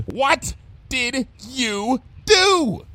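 very slow, high-pitched word-by-word demand; exasperated, drawn-out high demand. Acted. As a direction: drag out each word in alarm. Repeats question, cutting off the explanation.

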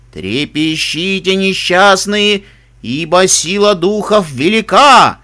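slow, loud, ominous spirit voice; booming echoing voice. Acted. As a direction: boom it out like an unseen witch. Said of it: "продолжала тем временем ведьма". (гулкий зловещий голос отовсюду)

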